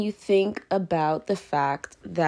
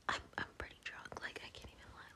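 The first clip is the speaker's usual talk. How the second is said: much softer whispered confession; 22 dB quieter, faster than usual. Real, not acted. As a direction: admit it under your breath. Drunk confession to listeners.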